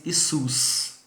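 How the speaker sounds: Jesus said the Romanian way, isus, slowly; Jesus as in Romanian, ee-SUS. Shown as a demonstration. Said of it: "e em romeno fala".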